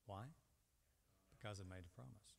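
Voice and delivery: usual speaking voice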